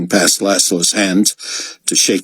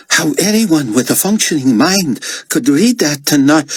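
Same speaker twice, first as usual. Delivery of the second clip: Albi's voice; 10 semitones higher than usual. Acted. Albi's excited account, cut off.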